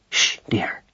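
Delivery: hushed, unvoiced shushing; whisper. A narrator's reading. Mouse mother hushes her baby, whispering. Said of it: "whispered Madeline".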